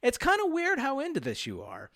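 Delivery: high mock-incredulous chief; imitating the chief. Voicing the chief watching Sharon, high and incredulous. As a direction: pitch it way up, teasing disbelief. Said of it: "the Chief's mind as he's watching her".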